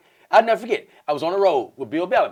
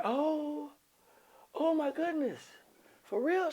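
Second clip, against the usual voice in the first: high, soft mock astonishment; hushed amazement; 11 semitones higher, 10 dB quieter, much slower than usual. Man answering her poly offer, quietly amazed. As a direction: react in a small high voice.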